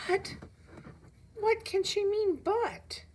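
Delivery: high and slow, shocked; stuck on the word but; stunned disbelief. A narrator's reading. Boy stunned by the teacher's but, high and slow.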